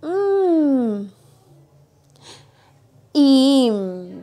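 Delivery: hum, then blend into "Im"; sounding out. Shown as a demonstration. (sonido m y sílaba "Im")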